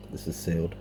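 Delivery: ordinary talk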